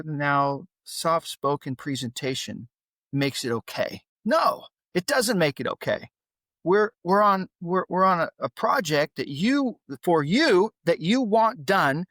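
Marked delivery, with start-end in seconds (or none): 4.2-6.1 sharp, higher-pitched refusal; retold anger. Dad retelling a clash with his son, angry. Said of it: "I was so angry". 6.6-12.1 heated, higher, emphatic pushing; retold anger. Dad retelling his argument over the unfinished project, angry. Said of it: "I was so angry".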